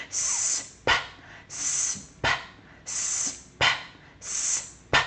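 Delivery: long hiss then a popped p; hissing s, then a quick lip pop; alternating soft s and popped p; s p drill. Shown as a demonstration. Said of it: "You might want a mirror".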